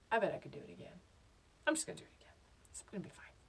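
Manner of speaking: whispering to oneself; secretive, very soft whisper; hushed thought. Acted. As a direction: sneak the words out under your breath. Thinking secretly beside sleeping gorilla.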